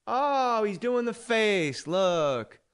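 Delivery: high, loud, drawn-out teasing voice; mocking imitation. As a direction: imitate a host gleefully heckling the impressionist. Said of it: "'cause he goes".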